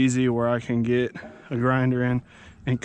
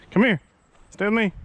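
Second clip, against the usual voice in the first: calling the dog; 8 semitones higher, faster than usual. Real, not acted. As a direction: call the dog over.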